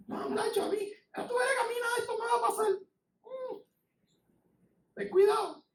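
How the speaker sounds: high, soft, cocky imitation; mock overconfidence. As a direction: play someone brushing off all danger. Mimics overconfident believer.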